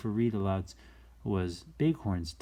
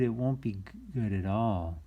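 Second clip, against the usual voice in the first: plain reading voice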